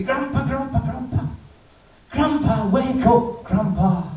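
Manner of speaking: put-on high little boy's voice pleading; small grandson begging grandpa to wake, high-pitched. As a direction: shake grandpa awake in a high kid voice.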